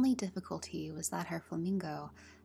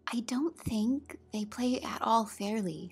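higher-pitched mild grumble; rather complaining; 5 semitones higher, 4 dB louder than usual. A narrator's reading. Alice grumbling to the cat, rather complaining. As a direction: complain about unfairness. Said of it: "in a rather complaining tone".

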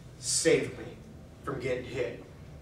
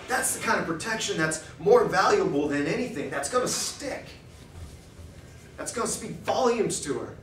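normal talking voice

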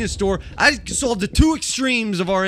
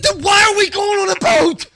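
exasperated shout; 11 semitones higher, 4 dB louder than usual. Retold or imitated. Retelling his outburst at Kelly.

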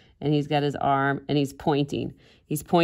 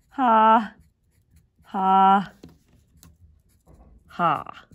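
spaced-out, higher, louder fake "ha"; mock laugh; 4 semitones higher, 4 dB louder, much slower than usual. Artist voicing her seated cartoon character, deliberate mock laugh. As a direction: act out a cartoon laugh, one beat at a time.

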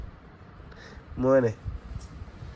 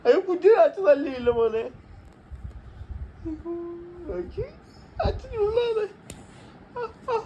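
mock crying. Parent mock-wails over the child.

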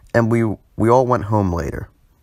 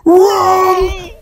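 high, loud shouted wrong; game-show shout; 8 dB louder than usual. Acted. Host shouting down his own guess at viewers. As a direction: yell it like a buzzer going off.

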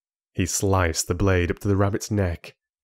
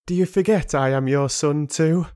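higher, shaky and angry; 7 semitones higher, 3 dB louder than usual. Acted. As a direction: protest with a trembling voice. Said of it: "the anger in Alec's shaky voice".

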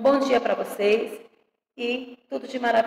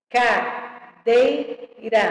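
very slow "Cadeira", ca-dei-ra in separate beats; syllable by syllable; much slower than usual. Shown as a demonstration. Teacher separating words for pupils, syllable by syllable. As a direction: count out each syllable of "Cadeira". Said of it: "separação de palavras e sílabas".

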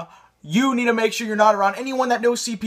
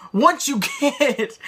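laughing; 5 semitones higher, slower than usual. Real, not acted.

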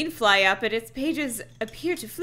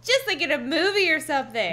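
put-on higher-pitched delighted girl; excited; 6 semitones higher than usual. A narrator's reading. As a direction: keep up the giddy excitement. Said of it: "we heard her exclaim excitedly".

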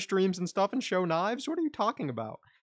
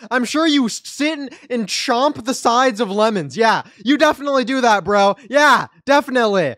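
loud sarcasm; 6 semitones higher, 10 dB louder than usual. Real, not acted. Sarcastic rant at chat.

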